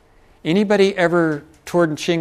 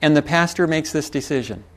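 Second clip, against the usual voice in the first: ordinary delivery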